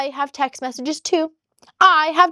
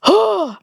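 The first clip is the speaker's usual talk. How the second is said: sharp gasp; 8 semitones higher, 3 dB louder than usual. Real, not acted. Reacting to his message.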